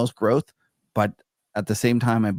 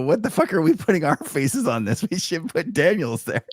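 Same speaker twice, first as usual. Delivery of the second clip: higher-pitched comic exclamation of disbelief; playfully appalled, pitched up; mock outrage; 6 semitones higher than usual. Host jokes to guest about faces on the thumbnail, mock outrage.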